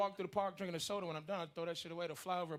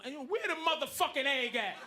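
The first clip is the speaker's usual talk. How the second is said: angry sperm shout; 10 semitones higher, 4 dB louder than usual. Acted. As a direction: storm in demanding the egg. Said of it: "my fucking sperm was like".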